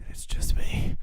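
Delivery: breathy whispered aside. Pretend. To listeners about a glitch, joking whisper. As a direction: whisper it like a secret.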